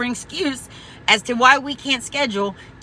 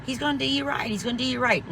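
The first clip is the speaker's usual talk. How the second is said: lightly voiced husband, higher, softer and fast; a hint of the husband, soft, quick and eager; imitating husband; 4 semitones higher, 6 dB quieter than usual. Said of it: "my husband's like".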